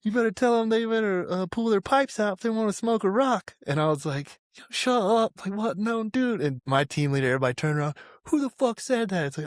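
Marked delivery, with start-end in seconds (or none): 0.0-3.5 high-pitched imitation of a sergeant; acted sergeant's remark. 4.5-6.4 high-pitched retold protest; acted protest. His startled retort in the story. 8.3-9.3 high-pitched mock challenge; retold angry demand. Team leader turns round, demanding.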